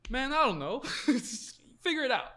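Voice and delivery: loud, high-pitched mock helplessness; retold exasperated brush-off. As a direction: throw up the question and toss it back, amused. Retold boss shrugs off the question.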